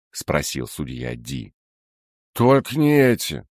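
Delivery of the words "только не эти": plain reading voice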